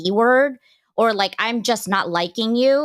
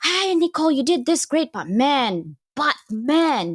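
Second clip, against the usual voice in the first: chirpy, higher manager praise turning to "but man"; imitating a manager; 6 semitones higher than usual. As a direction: mimic a boss who praises, then hedges. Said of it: "I see a lot of managers, um, tell you".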